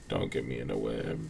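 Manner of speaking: "Don't get me in the web" said low and soft; low mock voice. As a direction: drop into a low, quiet put-on voice. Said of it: "repeated line, low then high".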